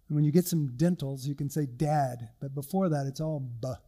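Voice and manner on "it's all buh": usual speaking voice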